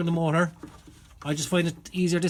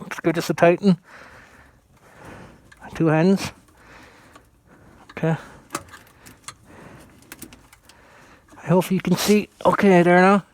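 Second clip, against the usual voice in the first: talking through a phone gripped in the teeth; phone held in teeth; 5 dB louder than usual. Real, not acted. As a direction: mouth full, words around the phone. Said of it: "I take that out of my mouth".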